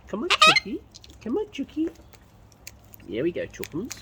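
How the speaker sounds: high sing-out to a pet bird; high-pitched coaxing call. Real, not acted. Calling a bird to come.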